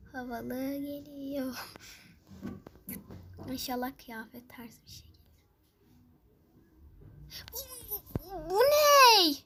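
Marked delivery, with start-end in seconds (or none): 3.5-5.2 hushed hopeful whisper. Real, not acted. Hoping quietly for outfit. 7.5-9.3 stammer, then a high loud cry; stammering, then loud disbelief; shocked outcry. Real, not acted. Shocked at bad reward.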